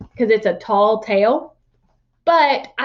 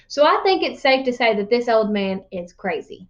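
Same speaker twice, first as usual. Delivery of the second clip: ordinary delivery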